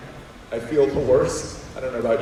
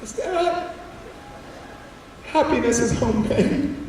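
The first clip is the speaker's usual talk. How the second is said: high-pitched mom voice; mom marveling; 9 semitones higher, 4 dB quieter than usual. Acted. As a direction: read it out high in mock wonder. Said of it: "and is like".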